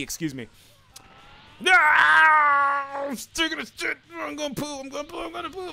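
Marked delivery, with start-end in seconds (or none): 1.7-5.7 high squeaky mock strain; silly straining voice. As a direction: play a squeaky cartoon straining.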